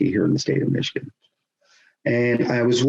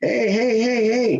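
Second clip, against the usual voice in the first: mock warning; 12 semitones higher, slower than usual. Referee warning heckling player.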